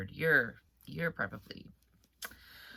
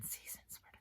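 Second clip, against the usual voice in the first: whispered aside; 26 dB quieter, faster than usual. Real, not acted. Aside about allergies.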